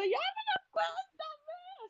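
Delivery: high, drawn-out eager plea; eager excitement. Retold or imitated. Begging dream friend Jande to tell, high and slow. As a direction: beg slowly to hear the secret, high. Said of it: "así emocionada".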